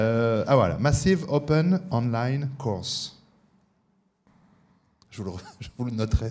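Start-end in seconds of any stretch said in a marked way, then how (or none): 0.8-3.2 slow English words with a French accent. Real, not acted. Host spells out MOOC to the audience in French-accented English. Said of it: "avec mon accent".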